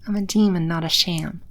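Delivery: ordinary talk, nothing marked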